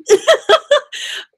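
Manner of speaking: a short, high giggle that breaks off; self-conscious laugh. Real, not acted. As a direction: laugh at yourself for rambling. Laughing at her own rambling.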